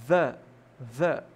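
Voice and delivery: THE twice, each a separate "thuh"; two punched "thuh"s for THE. Shown as a demonstration. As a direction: say THE with a short uh, pause, repeat. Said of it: "então eu vou pronunciar o".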